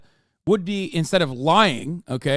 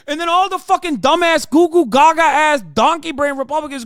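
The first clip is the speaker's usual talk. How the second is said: louder and higher, yelling insults; furious shout; 8 semitones higher, 7 dB louder than usual. Real, not acted. Streamer yelling insults at Republicans, furious. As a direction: hurl insults at full volume.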